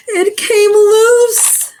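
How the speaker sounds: high, slow and loud; dramatic drawn-out exclaim. A narrator's reading. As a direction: draw it out with suspense. Dramatic story climax.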